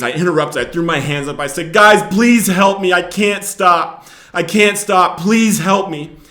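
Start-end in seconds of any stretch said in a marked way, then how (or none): 1.7-6.2 higher, louder, slow begging; desperate plea. Retold or imitated. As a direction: cry out for help, broken. Bursting into prayer, begging for help.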